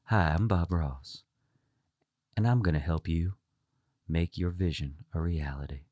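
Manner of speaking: gentle, low, hushed tone; soft, smooth and super calm, slowing down; Bob Ross. Acted. Painter welcoming TV viewers, gentle tone. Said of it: "he's got that gentle tone".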